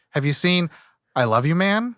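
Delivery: ordinary talk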